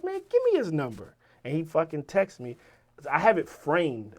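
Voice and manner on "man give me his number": imitated eager request, much higher; lightly squeaky, urgently; excited demand. [name] asking [name] for the writer's number, eager.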